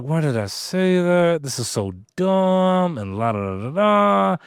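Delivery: a touch of regretful panic; mock panic. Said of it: "I'm freaking out now".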